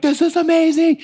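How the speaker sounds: imitating a buyer freaking out, high and loud; excited freak-out. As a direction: blurt it out like an overexcited customer. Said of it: "are freaking out".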